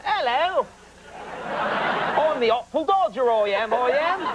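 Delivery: hammed-up Cockney chimney sweep, high; slow, high-pitched fake Cockney urchin; bad British accent. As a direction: overact a terrible stage Cockney. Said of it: "dressed as a chimney sweep".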